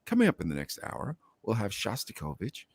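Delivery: low, hushed classical announcer; classical radio voice. Shown as a demonstration. Showing how he sounded on classical radio, low soft announcer. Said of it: "and I sounded like this".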